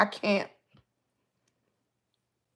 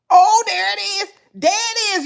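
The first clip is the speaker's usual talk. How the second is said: higher and louder, thrilled; excited find; 7 semitones higher, 4 dB louder than usual. Real, not acted. Finding the message for her viewers, an excited higher cry. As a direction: pounce on it with glee.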